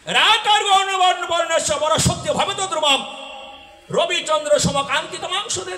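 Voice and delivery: high, loud sermon chant to the crowd; preaching at full force. Acted. Kirtan singer proclaims the holy verse to the crowd, loud and high.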